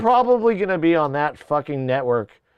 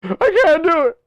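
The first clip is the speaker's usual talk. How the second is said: high, loud cry of defeat; high-pitched, helpless protest; whiny AI; 12 semitones higher, 5 dB louder than usual. Acted. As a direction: wail it like a gadget giving up. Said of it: "A I just was like".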